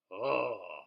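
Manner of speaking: ordinary delivery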